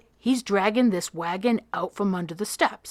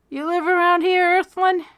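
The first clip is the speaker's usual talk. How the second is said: playful mock address; 11 semitones higher, 7 dB louder than usual. A narrator's reading. Max teases Freak back.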